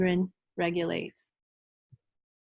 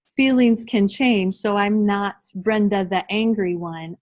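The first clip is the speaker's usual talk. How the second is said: ordinary talk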